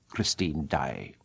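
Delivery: low, slow and soft, contemptuous; flung insult. Acted. Eric to Christine, her name low and slow like an insult. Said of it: "as though he were flinging an insult".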